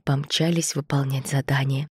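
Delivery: plain reading voice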